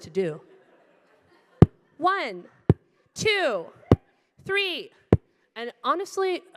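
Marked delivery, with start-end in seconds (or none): 2.0-4.9 high whooping trainer count; each number called high, sliding down; exuberant counting. Retold or imitated. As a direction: celebrate every single rep. Trainer counting high-five sit-ups.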